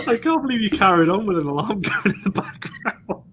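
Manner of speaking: high-pitched, laughing disbelief; amused disbelief. Real, not acted. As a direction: crack up while teasing a colleague, voice lifted high. Laughing at colleague ignoring the alarm.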